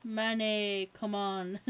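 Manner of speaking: ordinary talk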